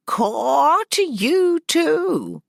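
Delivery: put-on high, loud, slow cawing old woman; imitating the raven. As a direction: caw it back like the raven. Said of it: "she was imitating Mousebones".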